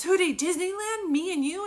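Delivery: put-on high, loud, childlike amazement; thrilled questioning, as if too good to be true; acting out breathless childlike delight; imitating excited sister. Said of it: "she gets all excited".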